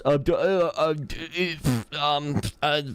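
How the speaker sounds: high, stumbling mock stammer; flustered stammer. Mocking the conservative in the meme, high flustered stammer. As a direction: play the guy caught with no answer. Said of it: "the Patrick Wallet meme".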